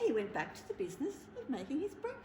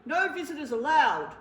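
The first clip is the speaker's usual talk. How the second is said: loud, firm refusal from a big bear; bear shouting a rule; 3 semitones higher, 10 dB louder than usual. Acted. Bear shouts the rule at the mouse, loud and stern. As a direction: bark the rule out at the door. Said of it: "Bear said, pointing to the sign".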